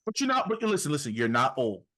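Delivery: normal talking voice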